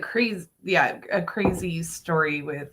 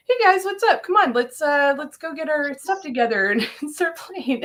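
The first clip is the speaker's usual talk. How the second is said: clueless cheery Joey; 9 semitones higher than usual. Retold or imitated. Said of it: "Joey walks out and goes".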